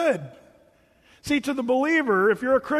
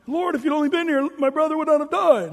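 high, loud mock lament to the Lord; hammed-up reproach, pitched well up; mock complaint; 7 semitones higher, 5 dB louder than usual. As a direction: plead it loudly, in a put-on high voice. Acts out sister complaining to Jesus.

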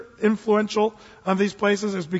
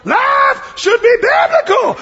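high, slow, drawn-out shouting; wild pulpit bellow, imitated; preacher at full mayhem; 8 dB louder, slower than usual. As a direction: scream it like a gospel preacher at his peak. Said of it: "it was just crazy mayhem".

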